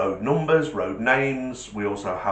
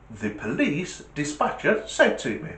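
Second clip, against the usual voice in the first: usual speaking voice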